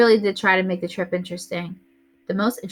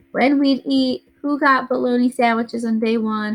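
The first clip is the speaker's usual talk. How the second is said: usual speaking voice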